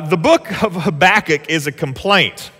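ordinary talk, nothing marked

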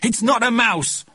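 put-on high, slow, plaintive child's protest; upset boy. A narrator's reading. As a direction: protest as a tearful little boy. Upset boy protests over pet.

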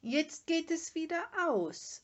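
high, loud needling call; pert little troublemaker. A narrator's reading. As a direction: pipe up with a pert warning. Little My heckles the fire-keeper. (vorlaut und hoch)

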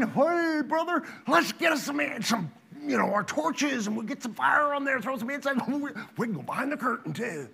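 tipsy sons. Retold or imitated. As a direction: pitch it up like a tipsy brother egging on the other.

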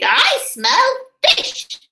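high, slow, sniffing seagull. A narrator's reading. Solomon Shriek the seagull sniffing out fish, high and slow. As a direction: savour the smell like a hungry gull. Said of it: "squawked a seagull called Solomon Shriek".

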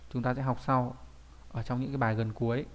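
usual speaking voice

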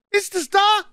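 very high, loud yell; roared question. A narrator's reading. Puh to man at window, roared question. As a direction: demand an answer at full shout. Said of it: "brüllte Puh".

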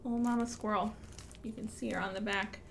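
ordinary delivery

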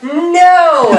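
high, drawn-out mock refusal; mock defiant refusal. Rowers refusing to give up the convict, mock defiance. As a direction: hold out a high, hammed-up no.

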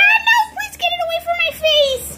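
high, panicked pleading; acted frightened squeal; scared. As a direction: plead to be saved from it. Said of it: "I'm so scared of it".

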